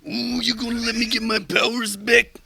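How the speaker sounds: Macho Man Titan. Acted. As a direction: swagger it like a pro wrestler. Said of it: "Macho Man Mad Titan voice".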